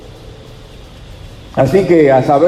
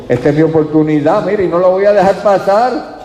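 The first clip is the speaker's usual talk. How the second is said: determined resolve; 5 semitones higher than usual. Retold or imitated. Bartimeo resolving not to let Jesus pass, determined. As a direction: pitch climbing with rising urgency. Acts blind man's determined thought.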